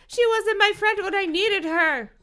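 very high, loud outburst; high-pitched, shouted accusation; exploding. A narrator's reading. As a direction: scream it in hammed-up fury. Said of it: "Dina exploded".

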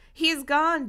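mildly dramatic outcry; exclaiming. A narrator's reading. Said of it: "Dina exclaimed".

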